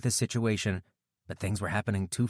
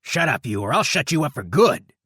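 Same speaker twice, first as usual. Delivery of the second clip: snarled threat; 6 semitones higher, 8 dB louder than usual. A narrator's reading.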